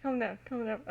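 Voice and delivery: ordinary delivery, nothing marked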